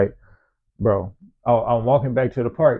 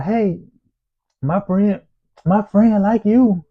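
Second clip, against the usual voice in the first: mock girl's voice; 9 semitones higher, 3 dB louder, slower than usual. Girl voiced in story.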